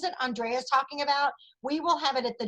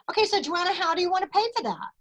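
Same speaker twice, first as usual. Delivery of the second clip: higher-pitched salesclerk patter; raised-pitch mock sales pitch; imitated shop clerk; 6 semitones higher, 3 dB louder, faster than usual. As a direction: lightly voice a clerk asking for payment. Acts shop clerk asking for payment.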